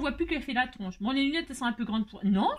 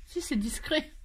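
ordinary talk, nothing marked

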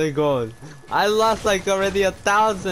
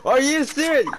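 incredulous; 10 semitones higher than usual. Real, not acted. Teammate refuses gifted gun.

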